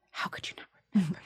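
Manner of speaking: quick, whispered offence; hushed disbelief. Retold or imitated. Actor retelling reaction to the director forgetting her, hushed disbelief. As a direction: whisper it, stunned and wounded. Stunned inner reaction to David Chase.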